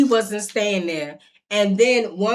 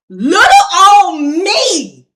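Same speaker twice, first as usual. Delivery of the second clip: put-on high, slow, loud little-girl voice; mock innocent; 7 semitones higher, 10 dB louder, much slower than usual. Telling the cops she is tiny, mock innocent high voice. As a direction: play small and innocent, drawn out. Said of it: "And I'm like".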